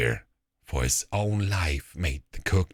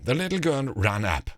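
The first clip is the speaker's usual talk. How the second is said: ordinary delivery, nothing marked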